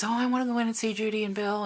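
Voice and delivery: put-on high, quick eager little boy voice; nine-year-old boy. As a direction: play the excited kid begging to visit. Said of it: "he says".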